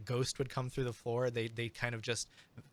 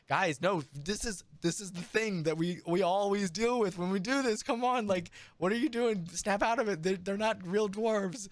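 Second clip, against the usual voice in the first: a bandmate begging the others to focus; exasperated bandmate; 7 semitones higher than usual. Acted. Bandmate pleading with the band to snap out of it, exasperated.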